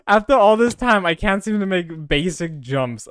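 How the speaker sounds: loud, high-pitched complaint; exasperated. Real, not acted. Player failing basic jumps after all this time, exasperated.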